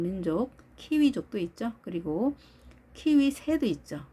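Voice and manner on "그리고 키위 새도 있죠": ordinary delivery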